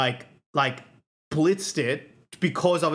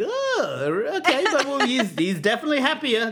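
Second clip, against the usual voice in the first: hesitant mock reassurance; 10 semitones higher than usual. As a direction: act out parents trying to sound okay with it. Acting out parents' doubtful reaction.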